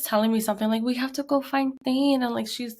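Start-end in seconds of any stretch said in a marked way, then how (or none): 0.8-2.2 small daughter's plea. Retold or imitated. Retold small daughter pleading.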